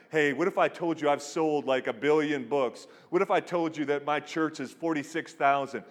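loud, punchy preacher's brag; mock big-shot conference speaker; building boast. Retelling pastor's boastful conference speech.

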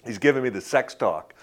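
normal talking voice

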